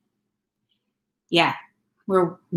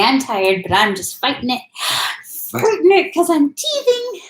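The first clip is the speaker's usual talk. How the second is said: put-on speaking for baby; 7 semitones higher than usual.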